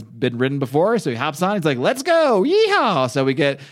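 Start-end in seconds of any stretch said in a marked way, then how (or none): 1.8-3.1 much higher, hammed-up cowboy whoop; rally the horse like a mock cowboy; mock cowboy cheer while retelling the comic; cowboy yeehaw. Rick mounting horse, voiced.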